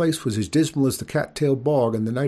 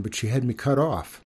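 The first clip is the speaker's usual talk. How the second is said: plain reading voice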